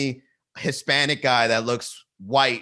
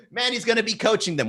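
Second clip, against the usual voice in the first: mock chat voice; 9 semitones higher than usual. Voicing chat commenter mockingly.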